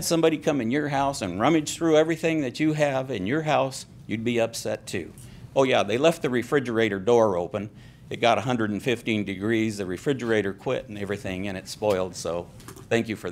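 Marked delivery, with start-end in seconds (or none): none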